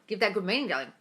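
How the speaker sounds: slight choking while speaking. Real, not acted. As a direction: speak through a tickle in the throat. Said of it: "Sorry, I'm choking here".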